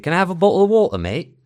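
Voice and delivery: put-on loud, high British accent with dropped Ts; casual British, glottal stops in "bottle" and "water"; northern English street accent. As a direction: blurt it in everyday British, swallowing the Ts. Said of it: "no digo la T".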